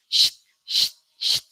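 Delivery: voiceless sht pushed out loud; hissed sh sound. Shown as a demonstration. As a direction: hiss sht like a shush. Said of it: "Suaranya kayak gitu".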